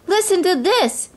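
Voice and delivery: high-pitched eager announcement; excited cry. A narrator's reading. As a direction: cry it out for everyone to hear. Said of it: "Drusilla and Anastasia cried".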